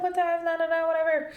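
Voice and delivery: dismissive. Retold or imitated. Retelling brushing off her mom.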